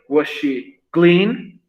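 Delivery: drawn-out model question, word by word; very slow demo. Shown as a demonstration. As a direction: stretch every word so students can copy it. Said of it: "Voy a utilizar clean". (pregunta modelo muy lenta, palabra por palabra)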